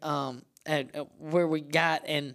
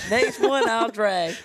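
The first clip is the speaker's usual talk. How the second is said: mock loud promise; 6 semitones higher, 5 dB louder, slower than usual. Acted reply, voiced loud.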